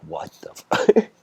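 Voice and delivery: a sharp, loud, slowed curse cut off mid-word; mock angry outburst. Bored kid blowing up at the silent guru, mock angry. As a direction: bite off the swear in frustration. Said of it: "gets so angry".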